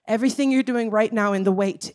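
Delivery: normal talking voice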